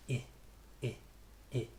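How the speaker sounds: short, lax "I" as in "it"; clipped relaxed "I" sound; relaxed short vowel. Shown as a demonstration. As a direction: say "I" short and loose, not as "ee". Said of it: "It's a relaxed sound".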